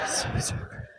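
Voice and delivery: hushed, nearly voiceless aside; awkward aside. Real, not acted. Waiting for audience questions, hushed awkward aside. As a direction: whisper it to yourself in the silence.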